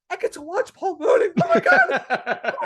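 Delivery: retelling her own thrilled squeal at the club, laughing; excited laughing squeal. Retold or imitated. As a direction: shriek with giddy joy at getting to watch your idol. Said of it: "And I'm like".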